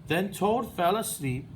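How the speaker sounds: ordinary talk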